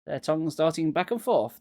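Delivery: plain reading voice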